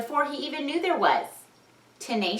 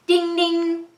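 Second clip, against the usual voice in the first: high ringing ding; bike bell; 9 semitones higher, 5 dB louder than usual. Acted. Bell of Ben's bike, high and ringing. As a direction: ring it like a bell.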